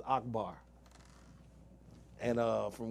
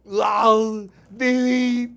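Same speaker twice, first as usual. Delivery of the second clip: high, loud, slow mock Austrian shouting; Arnold impression; 9 semitones higher, 11 dB louder, much slower than usual. Doing Arnold for his cohost, loud mock Austrian yells. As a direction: bellow it slowly like action-movie Arnold. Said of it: "I can do Arnold".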